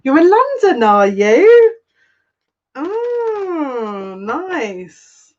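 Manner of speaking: pleasantly surprised. Real, not acted. Host reacting to a viewer now living in London, high surprised squeal. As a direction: caught off guard and delighted by the news.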